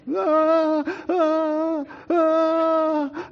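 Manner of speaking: high, loud pained wails; crying out loud. Retold or imitated. Boy wails at thawing hands, high and loud. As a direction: wail like thawing hands hurt. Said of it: "I'm crying out loud".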